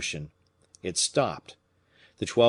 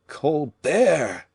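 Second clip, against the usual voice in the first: voice broken by emotion; 7 semitones higher, 6 dB louder than usual. Acted. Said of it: "in a voice broken by emotion".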